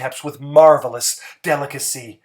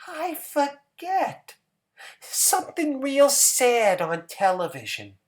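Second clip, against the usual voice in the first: high, small voice; simple Hazel; 10 semitones higher than usual. A narrator's reading. Hazel answering George, high and small.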